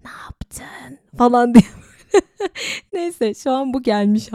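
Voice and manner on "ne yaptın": breathy, raspy voiceless whisper. Shown as a demonstration. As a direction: rasp it out like a creepy caller. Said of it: "Şu şekilde".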